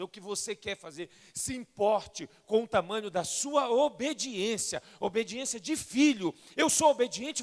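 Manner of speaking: loud, rising sermon cry; fervent preaching. Acted. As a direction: preach it with full force to the congregation. Preaching at full force. (pregação fervorosa e alta)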